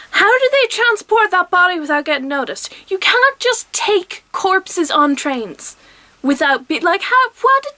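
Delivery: exasperated disbelief. Real, not acted. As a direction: sputter at the absurdity, losing words. Ranting about plot hole.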